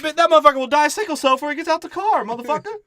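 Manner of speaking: high, loud sassy lady voice, hammed up; shrill it back at him like an offended neighbor. Lady threatening, still in voice.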